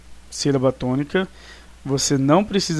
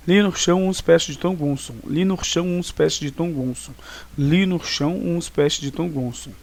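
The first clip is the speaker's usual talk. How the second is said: run together as one phrase. Shown as a demonstration. Teacher drilling a mnemonic for the class, run together as one phrase. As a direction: chant the letters as a memorized sentence. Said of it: "memorizar como se fosse uma palavra, uma frase".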